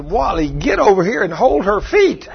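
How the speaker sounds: high-pitched order for help; mildly hollered command; retold holler. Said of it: "And I hollered".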